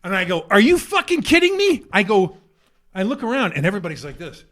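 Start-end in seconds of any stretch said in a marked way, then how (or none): 0.5-1.8 high, loud indignant question; retold outburst at the crowd; outraged disbelief. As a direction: throw it out in exasperated disbelief.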